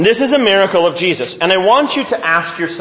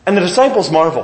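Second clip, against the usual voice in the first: normal talking voice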